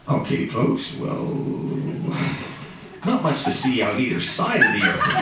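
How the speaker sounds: deadpan pilot over the intercom; pilot announcement voice. Acted. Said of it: "Why do pilots talk like that?".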